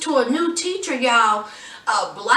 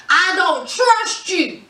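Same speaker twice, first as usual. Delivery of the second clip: said with a smile; 3 semitones higher, slower than usual. Retold or imitated. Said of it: "with a smile".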